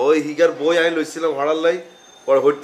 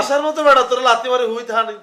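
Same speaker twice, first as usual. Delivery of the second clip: angry berating; 8 semitones higher than usual. Acted. Elder berates youth who got kicked, angry and high. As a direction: lay into him, pitch shooting up.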